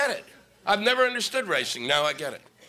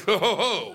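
mock evil laugh. Imitating Dick Cheney's evil chuckle. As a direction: chuckle like a villain.

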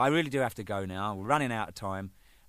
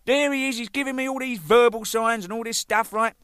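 retold rant; 11 semitones higher, 6 dB louder than usual. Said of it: "I said".

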